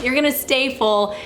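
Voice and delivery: ordinary delivery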